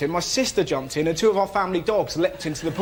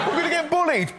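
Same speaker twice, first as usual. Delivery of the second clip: much higher put-on child voice; worried little girl; 11 semitones higher than usual. Girl with man hand on first school day, mock-worried child voice. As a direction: pitch it right up as an imitated schoolgirl. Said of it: "I'm a little girl".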